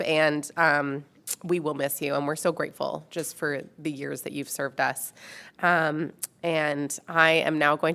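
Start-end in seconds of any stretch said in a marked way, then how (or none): none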